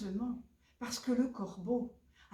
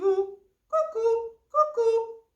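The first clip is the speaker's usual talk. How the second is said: high, loud two-note bird call; cuckoo; 13 semitones higher, 11 dB louder, much slower than usual. Acted. Cuckoo spots the fleeing fish, cuckoo call. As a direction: hoot it out, falling on the second note.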